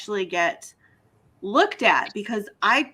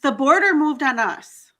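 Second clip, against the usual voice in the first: BORDER hit hard, louder and higher; emphatic punchline; 5 semitones higher, 5 dB louder than usual. Real, not acted. Great-granddaughter correcting a myth, louder with stressed border. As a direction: land it as a firm correction. Indignant point about border history.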